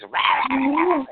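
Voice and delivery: high, furious cartoon sputtering; Donald Duck. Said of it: "Donald Duck when he gets really ticked off".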